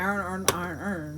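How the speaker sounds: Baltimore "Arn, arn, arn" with a flat nasal arn; Baltimore accent. Retold or imitated. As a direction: mimic the Baltimore guys saying "arn". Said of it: "listen to them talk".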